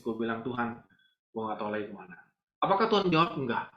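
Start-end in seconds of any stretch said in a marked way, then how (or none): 0.4-2.2 tearful prayer. Retold or imitated. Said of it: "gue nangis".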